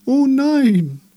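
very high, loud wail; dismayed chorus. A narrator's reading. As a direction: shriek of theatrical dismay. Trees' alarmed chorus.

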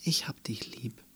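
whispered tenderly; soft whisper. Acted. Andy hugging Clarence, whispering gently. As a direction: breathe the name softly, without voice. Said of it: "sagte sie sanft".

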